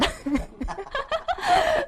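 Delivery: high laughing burst that falls away; real amused laugh; burst of laughter. Real, not acted. Laughs at own joke.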